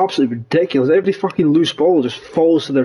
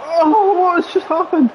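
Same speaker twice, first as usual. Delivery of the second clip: high-pitched baffled exclamation; stunned disbelief. Real, not acted. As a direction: react to sudden chaos. Confused by scramble in box.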